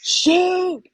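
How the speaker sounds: high-pitched mock fan yell. Coach imitating a fan yelling from the stands, mock yell. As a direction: call it out high, imitating a heckler. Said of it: "or someone yelling".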